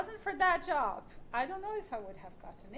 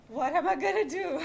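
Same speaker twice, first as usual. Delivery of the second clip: higher and louder, a hammed-up cry of panic; retold despair; 5 semitones higher, 10 dB louder than usual. A job seeker reliving her panic after the interview, mock despairing. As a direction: throw up your hands, what now.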